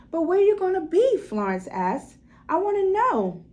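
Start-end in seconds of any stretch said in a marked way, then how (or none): 0.1-1.2 put-on high, louder child's plea; girl pleading with her daddy; anxious little girl. Anxious child asks father. 2.5-3.4 put-on high, louder child's whine; insistent little girl.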